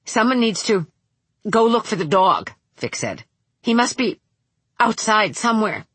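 breathless, stopping every few words; gasping for air between phrases; out of breath. Acted. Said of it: "She had to stop every few words to catch her breath".

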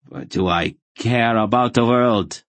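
full of scorn, pitch lifted; contemptuous. A narrator's reading. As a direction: throw it out with open contempt. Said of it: "Rogue's voice was filled with contempt".

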